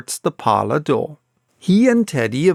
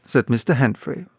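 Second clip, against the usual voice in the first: plain reading voice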